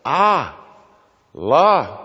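slow strained "ah... la"; imitating Terry's struggle to say I love; imitated "ah la". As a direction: mimic a girl's effortful syllables. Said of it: "She'd say, "Let's say I".

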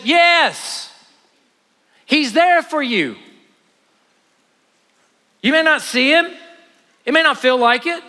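high, impassioned sermon delivery; punchy high-pitched pulpit proclamation; full-force preaching. Acted. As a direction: preach it loud and high, leaning on key words.

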